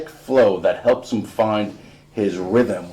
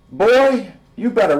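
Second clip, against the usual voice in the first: higher and louder; mock scolding; 11 semitones higher, 6 dB louder than usual. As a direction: call him out. Dad scolding son.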